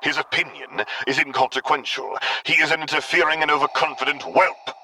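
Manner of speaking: contemptuous dark lord; dismissive snort. A narrator's reading. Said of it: "Vader snorted".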